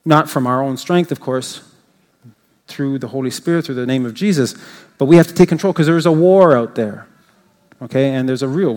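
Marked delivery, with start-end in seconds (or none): none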